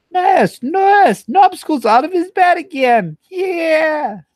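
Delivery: squeaky high loud cartoon voice; Animaniacs voice. Streamer attempts an Animaniacs character, high and loud. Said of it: "really poor Animaniac".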